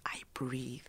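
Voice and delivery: whispered, almost breathless with calm; hushed calm. Real, not acted. Telling the host how she now meets challenges, whispering softly. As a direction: let it fall to a near-silent whisper.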